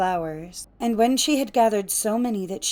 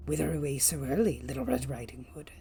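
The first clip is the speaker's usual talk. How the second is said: low, soft wolf voice; quiet, low storybook wolf; sly wolf; 5 semitones lower, 7 dB quieter, faster than usual. A narrator's reading. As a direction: greet her as the lurking wolf, hushed and low. Wolf questions the girl.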